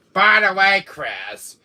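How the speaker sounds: high, loud call for attention; loud interjection. Acted. Villain turning on Chris, loud. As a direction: throw it out at full volume.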